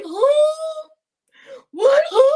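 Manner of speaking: high, loud, bewildered squeal; mock confused Egon. Absent friend Egon hearing he missed the stream, mock outraged squeal. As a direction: play the friend who missed everything. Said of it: "Egon is gonna be like".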